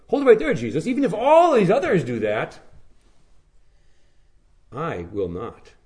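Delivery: offended Peter setting Jesus straight; insulted protest. Acted. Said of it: "Peter's insulted by that".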